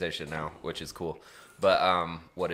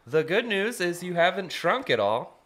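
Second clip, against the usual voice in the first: nurse voice; 9 semitones higher than usual. Retold or imitated. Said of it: "and she goes".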